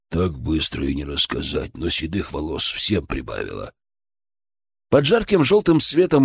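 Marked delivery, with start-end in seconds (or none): 0.1-3.7 soft and deep, weary answer; hushed, heavy admission; low, subdued reply. A narrator's reading. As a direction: answer low, with little energy. Weary answer about hard times.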